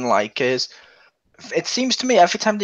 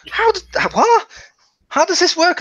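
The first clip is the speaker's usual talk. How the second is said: high, louder baffled cry; baffled outburst; 4 dB louder than usual. Retold or imitated. Mocking a baffled viewer, high and louder. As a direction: act stunned at the nonsense. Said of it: "And you're like".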